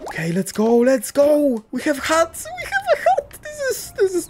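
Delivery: very high-pitched giddy squeal; excited squeal. Real, not acted. Player cheering at finally having hats, excited squeal. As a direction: squeal with thrilled delight, voice leaping right up.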